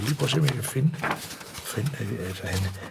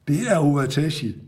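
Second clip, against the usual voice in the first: usual speaking voice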